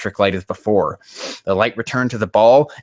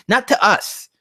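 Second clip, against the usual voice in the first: normal talking voice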